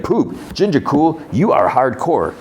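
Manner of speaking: high-pitched, teasing praise; mock admiration. [name] teases Economos about his dark wish, mock admiring.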